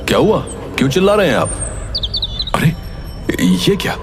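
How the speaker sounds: usual speaking voice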